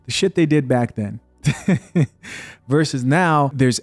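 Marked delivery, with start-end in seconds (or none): 1.4-2.4 short amused chuckle. Real, not acted. Host amused by his own remark, chuckle. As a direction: let out a brief laugh.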